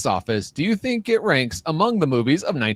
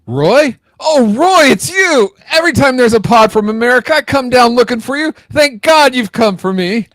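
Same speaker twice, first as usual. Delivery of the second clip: high, loud, gushing relief; overjoyed film heroine; 7 semitones higher, 5 dB louder than usual. Acted. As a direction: cry out as if rescued at last. Acts out film's frantic greeting of Roy.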